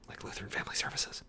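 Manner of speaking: fast breathy whisper; a quick sneering whisper; whispered aside. Real, not acted. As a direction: toss it off under your breath. Sarcastic aside while reading.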